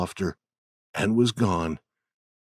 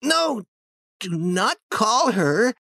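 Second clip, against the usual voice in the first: put-on pleading boy voice; 12 semitones higher, slower than usual. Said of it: "he cried loudly again".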